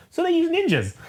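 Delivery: mock punchline. Elektra explaining the ninja guards, high mock reveal. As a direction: pitch leaps up on the last words.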